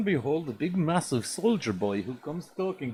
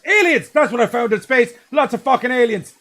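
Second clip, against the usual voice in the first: much higher, loud frantic yelling; shouting; 11 semitones higher, 11 dB louder than usual. Pretend. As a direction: scream it like a crazed astronaut. Mock hero blurting at podium.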